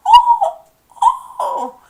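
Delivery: very high squealed "oh"; mock high-pitched yelps of pain; pained groan. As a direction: imitate a man kicked where it hurts. Acting kicked man's pained cry.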